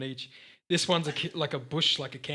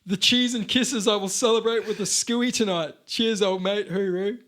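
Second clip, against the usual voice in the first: hammed-up ocker bloke; 5 semitones higher, 4 dB louder than usual. Hammed-up ocker commenter, shouting.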